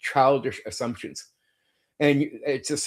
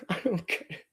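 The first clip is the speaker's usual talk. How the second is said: disbelieving laugh; 5 semitones higher, 10 dB quieter than usual. Real, not acted.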